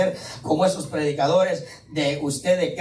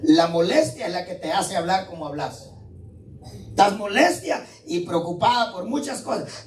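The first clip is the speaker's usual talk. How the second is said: normal talking voice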